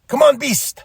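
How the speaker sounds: high-pitched playful call; mock challenge. Bandito holding his cape out to Beast, playful taunt. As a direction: egg on an opponent, pitched right up.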